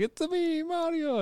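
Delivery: very high, soft video-game hero catchphrase; Mario. Acted. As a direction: squeak it with an Italian cartoon lilt. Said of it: "a Mario line".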